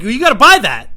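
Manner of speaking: high, very loud indignant yell; hammed-up scolding shout; screaming. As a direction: scream the demand at him. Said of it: "I'm screaming that like that".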